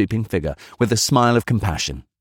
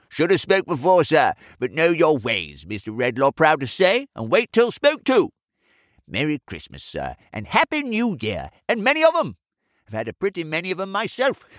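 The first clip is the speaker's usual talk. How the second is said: put-on high, swooping old man's voice; elderly man; 9 semitones higher than usual. A narrator's reading. Old man wishing the chemist merry Christmas, high and cheery. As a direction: pipe up proudly like an old fellow.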